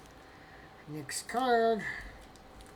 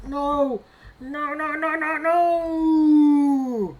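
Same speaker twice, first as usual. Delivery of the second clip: a rising, drawn-out wail of no, much louder and higher; anguished no; 7 semitones higher, 10 dB louder, much slower than usual. Real, not acted. Collector sees his card got a four, drawn-out anguished wail. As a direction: cry out in dismay as the grade is revealed. Card comes back with low grade.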